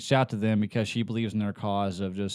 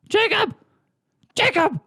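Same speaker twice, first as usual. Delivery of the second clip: high, loud desperate shouting of a name; Heavy Rain Jacob yell; 6 dB louder than usual. Retold or imitated. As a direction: scream the name like a frantic dad. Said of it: "Heavy Rain".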